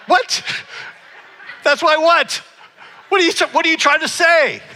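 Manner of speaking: high, mock-outraged demand; offended disbelief. Client retorting to the stylist about his nose, mock-offended. Acted offended reply to hairdresser.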